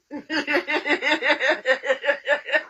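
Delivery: long, even chuckle; real laugh. Real, not acted. Laughing at her own six-pack boast, long real giggle. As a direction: break into a long run of giggles.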